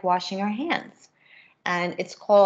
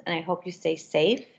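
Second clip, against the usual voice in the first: ordinary delivery, nothing marked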